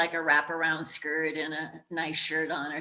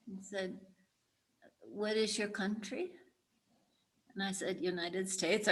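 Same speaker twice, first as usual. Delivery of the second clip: much softer, hushed retelling; 8 dB quieter than usual. Real, not acted. Recalling a frightened first exchange, hushed.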